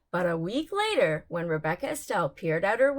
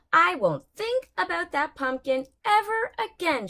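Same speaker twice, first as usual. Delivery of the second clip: higher, louder firm declaration; declaring; 7 semitones higher, 4 dB louder than usual. Acted. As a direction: announce it like a vow. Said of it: "she declared".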